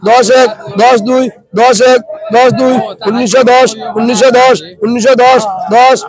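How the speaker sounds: steady rhythmic bid calling; auctioneer. Real, not acted. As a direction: chant the rising prices like a market auctioneer. Calling bids at fish auction.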